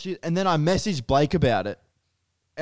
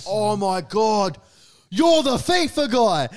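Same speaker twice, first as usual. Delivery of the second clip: high, drawn-out fan gushing; excited fans; 5 semitones higher, much slower than usual. Retold or imitated. Group of people recognizing him at the bar, excited fans. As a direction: gush slowly, rising high, imitating a fan.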